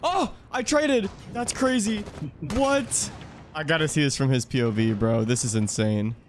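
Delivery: loud, high shocked shouting; excited yelling. Real, not acted. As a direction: shout in disbelief at the screen. Shocked reaction to game trade.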